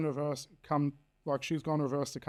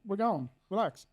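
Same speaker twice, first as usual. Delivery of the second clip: higher, exasperated relax; 6 semitones higher than usual. Retold or imitated. Dad to the ex blocking him, exasperated and pitched up. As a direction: tell her to back off, voice pitched up. Confronting the ex outside.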